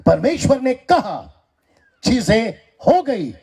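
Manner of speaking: high, slow, drawn-out proclamation; full-force preaching. Acted. Preacher proclaims God's creation to the congregation, emphatic preaching. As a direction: preach it with long ringing phrases.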